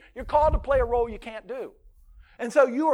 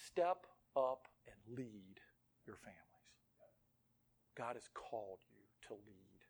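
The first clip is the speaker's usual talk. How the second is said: hushed charge; 11 semitones lower, 17 dB quieter than usual. Real, not acted. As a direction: press it home softly, word by word. Solemn charge to husbands.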